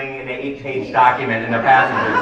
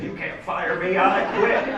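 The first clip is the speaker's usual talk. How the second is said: high, loud defiant quit; loud quitting; 7 semitones higher than usual. Retold or imitated. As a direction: shout it as you storm out. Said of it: "are loud quitting".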